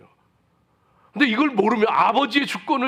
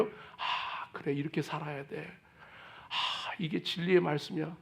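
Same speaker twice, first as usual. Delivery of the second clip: hushed inner thought; 4 semitones lower, 10 dB quieter than usual. Acted. Believer's inner resolve, whispered.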